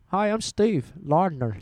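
put-on slow, failed Irish accent; Steve Lardner. Podcaster imitating an Irish friend, clumsy Irish accent. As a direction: have a go at an Irish accent, drawn out. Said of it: "I can't do an Irish accent".

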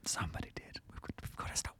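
hurried hushed whisper; frantic whisper. Acted. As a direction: whisper urgently to stop. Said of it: "why are we whispering".

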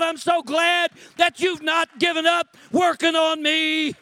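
high, loud pulpit shout; preaching at full force. Acted. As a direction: drive the altar call home.

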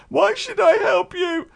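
high, loud, slow mock shout; a put-on indignant call from a window; imitating the girlfriend. The girlfriend shouting down from her window, high and slow.

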